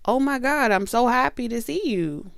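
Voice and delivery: high, bright gushing hello; delighted greeting. Retold or imitated. Friend at the coffee shop seeing you again, high excited gush. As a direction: squeal it like a happy reunion.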